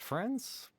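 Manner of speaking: high, rising disbelieving echo; sharply rising, incredulous; surprised question. Acted. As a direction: echo the word, caught off guard. Doubtful echo of a word.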